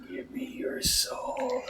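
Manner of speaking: high breathy whispered threat; menacing whisper. Acted. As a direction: whisper it slowly like a ghoul. Menacing line to foe.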